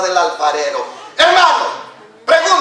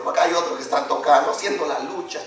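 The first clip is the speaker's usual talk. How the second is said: ordinary delivery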